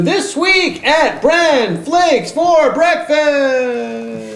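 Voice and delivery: high slow booming segment intro; old radio announcer. Pretend. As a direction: stretch out the segment title grandly.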